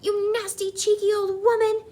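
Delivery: high, loud outraged insult; angry shout. A narrator's reading. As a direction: build to an indignant yell. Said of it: "shouted Mister Jenkins".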